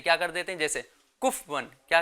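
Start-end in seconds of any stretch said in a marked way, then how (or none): 1.2-1.7 کفون in two syllables, kuf-wan, low; vowelless fa mistake. Shown as a demonstration. Teacher shows children the error, low kuf-wan for کفون. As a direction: drop the vowel after f in کفون. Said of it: "فا کو ساکن کر دیتے ہیں".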